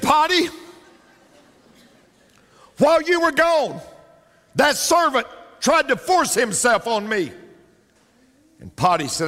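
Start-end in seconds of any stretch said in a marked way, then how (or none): 0.0-7.3 high, pleading wife running to her husband; mock-innocent wife crying foul; whiny wife voice. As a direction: play the wronged wife, pitched up.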